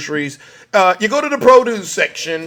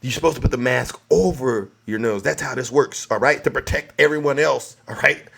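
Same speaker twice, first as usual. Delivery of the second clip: normal talking voice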